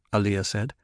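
usual speaking voice

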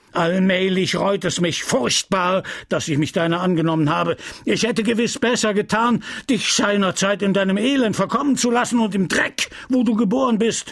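apothecary raging at the orphaned apprentice; raging again. Acted. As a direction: lash out at the boy anew. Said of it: "Und er tobte von neuem".

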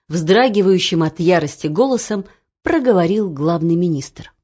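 plain reading voice

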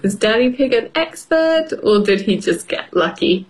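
plain reading voice

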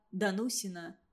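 usual speaking voice

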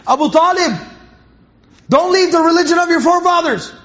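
high, forceful mock yell; imitated screaming. Abu Jahl to a dying man in a small room, imitated screaming. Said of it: "Started screaming loudly".

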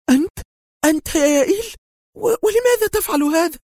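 very high stammering shocked cry; stunned horrified woman; pale, hoarse voice. A narrator's reading. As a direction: reel from the confession. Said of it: "في صوت شاحب مبحوح".

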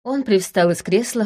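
plain reading voice

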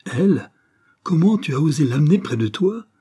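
high, loud outraged questioning; shocked indignation. A narrator's reading. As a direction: confront your son, aghast at what he dared. Mother learns enemy's daughter is here. (indignation stupéfaite, voix haute et forte)

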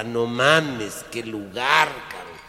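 normal talking voice

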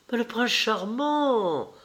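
high, drawn-out, delighted exclamation; excited exclamation. A narrator's reading. Excited sister points out beloved. (exclamation ravie et traînante)

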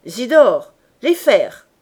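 loud call across the roof; a roofer's loud call to his apprentice. Acted. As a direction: shout up to the boy for the irons. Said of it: "Coupeau jeta son appel".

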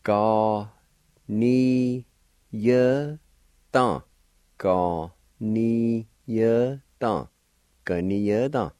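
"Ga, ni, ye, dan" spaced out, one syllable at a time. Shown as a demonstration. Teacher repeating "Ga, ni, ye, dan" for students. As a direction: drill "Ga, ni, ye, dan" slowly and clearly. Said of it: "pronounced like this".

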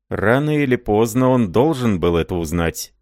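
ordinary delivery

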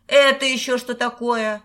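громовой голос. A narrator's reading. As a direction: boom it out at a boy caught snooping. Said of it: "показавшийся Петьке громовым". (громовым голосом)

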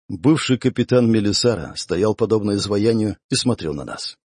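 usual speaking voice

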